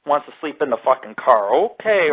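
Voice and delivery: ordinary talk, nothing marked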